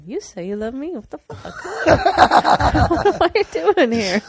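high, laughing mock outrage; incredulous retort. What she felt like saying back, hammed-up incredulous. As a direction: build from disbelief to a loud laughing shout. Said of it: "I always felt like saying".

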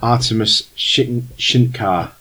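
a lightly voiced formal stranger, unhurried; Germanish accent. Game master as the old stranger offering the players a job, lightly German. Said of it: "he says".

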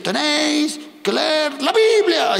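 put-on high, slow preachy old woman; old prophetess. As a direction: imitate her slow, pointed warning. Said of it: "Y decía".

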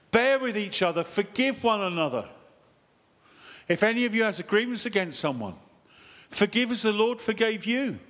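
loud, high proclamation of scripture; scripture reading. Real, not acted. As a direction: declaim the command to the whole congregation.

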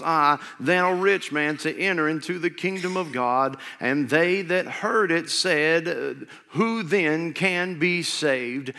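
slow, solemn Bible reading; scripture reading. Acted. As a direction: read it out, weighty and deliberate. Reads scripture aloud.

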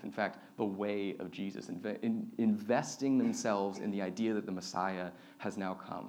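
ordinary delivery

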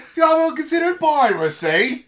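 hammed-up Cockney pirate offer; Cockney accent. Host re-doing his piracy offer in a loud Cockney accent. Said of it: "went full on Cockney".

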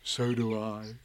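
low, soft bear voice; tender Pooh. A narrator's reading. Bear answering the boy's love, tender. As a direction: answer softly and fondly. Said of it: "said Pooh".

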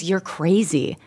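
said softly through a smile; smiling taunt. Retold or imitated. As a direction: imitate a sister's smiling put-down. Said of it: "looking at me and smiling".

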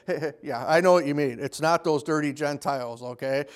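mock Jesus agreeing in a low, sly voice; lawyer's hoped-for answer from Jesus, low and sly; conspiratorial aside. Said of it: "he was hoping Jesus was gonna say".

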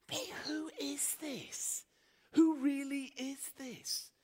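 hushed, slow, awed whisper. Retold or imitated. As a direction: whisper it as if afraid to be heard. Awed disciples whisper to each other.

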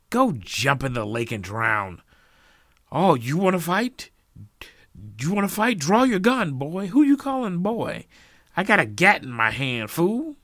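loud, high, hammed-up threats; hostile shouting match. A narrator's reading. Feuding families threaten each other, shouting angrily. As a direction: taunt them at full voice, spoiling for a fight.

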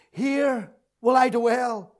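usual speaking voice

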